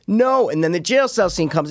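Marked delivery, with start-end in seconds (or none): 0.1-0.5 incredulous no. Retold or imitated. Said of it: "I'm going".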